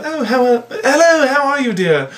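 high-pitched genteel English greeting; mock British accent. English stranger greeting the visiting American, mock British accent. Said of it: "in England".

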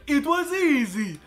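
high, loud and slow smug imitation; quoting the teacher. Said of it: "they'd be like".

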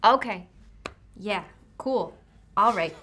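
ordinary talk, nothing marked

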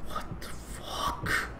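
slow scared whisper. Real, not acted. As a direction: whisper it slowly in fear.

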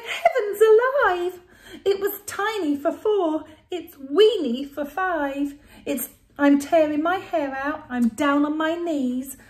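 high, loud, frantic wail; loud cry. Acted. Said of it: "The little old lady cried".

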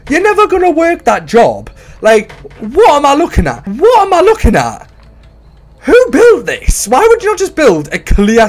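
who on earth built this; slowing on who built this; incredulous rant. Real, not acted. Ranting at silly obstacle course.

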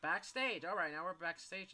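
loud, high burst of surprise; startled and worked up; excited. Real, not acted. Startled streamer in horror game.

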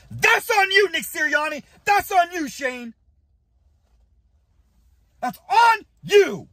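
high angry accusation; accusing yell. Real, not acted. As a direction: point the finger and yell. Blaming the coaches.

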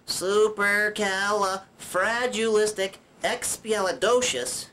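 very slow, drawn-out long word; syllable by syllable. Shown as a demonstration. Puppet shows viewers each mouth opening, syllable by syllable. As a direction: stretch out every syllable. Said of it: "You could do this".

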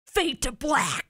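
mock angry. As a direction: put on an angry face. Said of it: "your voice is doing an angry".

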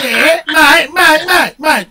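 high, loud "G'day, mate" with a long "mate"; Aussie greeting. Shown as a demonstration. Showing a friend how to greet Aussies, loud and high. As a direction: call it out like a cheery Aussie. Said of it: "If you can play that word".

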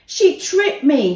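loud, high, slow wail of complaint; howling complaint. A narrator's reading. Tim accuses Danny to the ref, loud howl. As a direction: howl it like a faked injury. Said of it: "he howled".

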